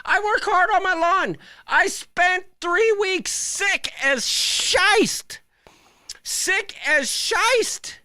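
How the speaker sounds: high, slow, drawn-out mocking whine; a high, slow whiny mock brag; mock sing-song brag. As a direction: parody a self-pitying homeowner, high and stretched.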